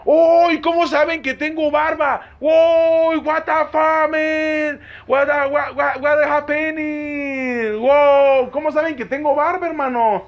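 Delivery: hammed-up astonishment, dragged out; over-the-top excitement. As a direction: gush in mock wonder, pitched high. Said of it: "me emocioné".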